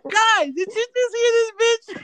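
high-pitched, through helpless laughter; incredulous laughing. Real, not acted. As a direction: squeal it to the listeners, cracking up. Cracking up at friend's odd phrase.

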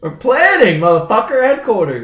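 high, loud exasperated yell. Real, not acted. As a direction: shout it out in frustration. Exasperated at teammate.

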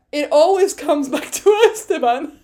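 high, breaking up with laughter; laughing. Real, not acted. Fan cracking up over her obsession with Esteban, laughing helplessly. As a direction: squeal it through real laughter.

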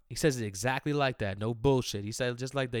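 normal talking voice

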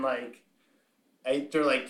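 ordinary talk, nothing marked

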